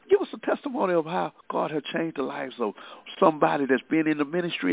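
usual speaking voice

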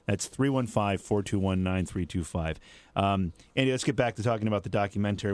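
normal talking voice

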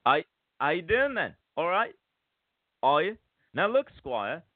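put-on Cockney greeting; Cockney wide-boy patter; Cockney accent. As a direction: play a chummy Cockney sizing someone up. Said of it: "trying a Cockney accent".

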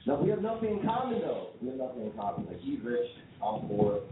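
ordinary delivery

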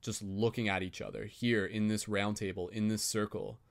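soft, even meditation voice; guided meditation. Acted. Facilitator guiding the group circle in calm meditation voice. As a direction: gently guide the circle inward.

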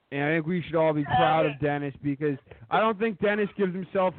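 mock-solemn toast speech; formal toast. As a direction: deliver it like a wedding toast.

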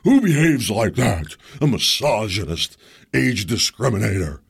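loud, slow mock outrage; hammed-up indignant scold; mocking accusers. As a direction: parody the outraged commentators. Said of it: "video of me yelling".

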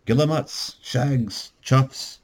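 thick Irish brogue. A narrator's reading. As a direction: list them as an Irish guide. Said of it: "purred a thick Irish brogue".

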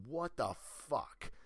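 mock hothead turtle, fed up; Ralph losing it. Ralph fed up with the pizza party, mildly exasperated. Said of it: "Ralph's like".

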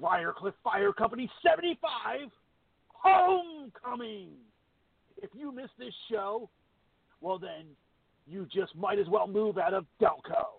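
booming high, stretched-out promo voice; monster truck announcer. Shown as a demonstration. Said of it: "I can do my monster truck announcer guy".

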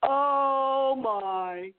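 a faint eerie-host oh my, fading out; half-hearted late-night host gasp; Art Bell's tone. Retold or imitated. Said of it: "in that tone of voice".

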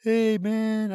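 a high, loud, bright-eyed hello down the phone line; voicing a buddy who rings him up to say hi; friend's cheery phone hello. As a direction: greet him like an old buddy calling long distance.

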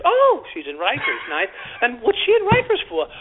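high, mock-sweet sarcasm; sarcastic cop. Cop mocking a girl's Rikers answer, high sarcastic voice. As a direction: echo her answer with fake cheer.

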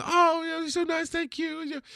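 very high mock-sweet lady voice; gushing stranger. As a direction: imitate a lady gushing her thanks. Stranger hands the baby back.

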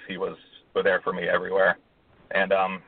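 ordinary delivery

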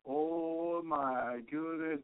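slow, hushed "Oh my goodness" in wonder; awestruck. Retold or imitated. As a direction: breathe it out softly, overwhelmed. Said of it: "I was like".

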